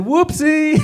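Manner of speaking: high, light and dainty; mock dainty whoopsie. Driver cutting someone off, high and dainty. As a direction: squeak it out innocently. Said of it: "I said".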